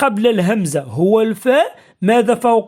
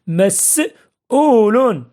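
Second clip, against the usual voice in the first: مسؤول very slow, split by a pause after the first syllable; much slower than usual. Shown as a demonstration. Teacher dictating an example to pupils, مسؤول slowly in parts. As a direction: hold each part of مسؤول, with a gap between.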